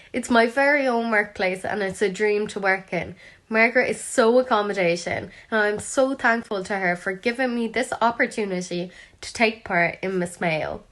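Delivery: usual speaking voice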